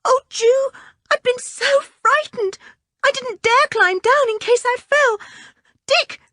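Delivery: put-on a small girl still shaking with fear; frightened little girl.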